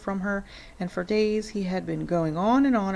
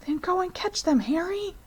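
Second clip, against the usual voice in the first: hissed urging; 12 semitones higher than usual. A narrator's reading. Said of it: "hissed the mother".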